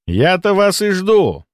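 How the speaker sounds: high, slow, put-on welcome; falsely gracious, lifted voice; feigned cordiality. A narrator's reading. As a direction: draw it out with fake delight. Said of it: "Он изобразил подобие радушия".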